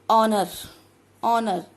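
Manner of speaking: 'ऑनर' starting on the o vowel, no h; silent H. Shown as a demonstration. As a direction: sound out 'ऑनर' loudly without h. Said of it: "एच is silent word". ('ऑनर' बिना ह ध्वनि के, ज़ोर से)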